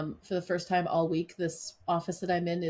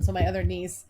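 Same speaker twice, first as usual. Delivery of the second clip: ordinary delivery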